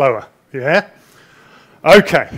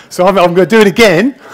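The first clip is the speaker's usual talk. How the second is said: louder, quicker, laughing through it; 4 semitones higher, 9 dB louder, faster than usual. Real, not acted. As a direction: laugh it out as you admit it. Laughing about repeating his presentation.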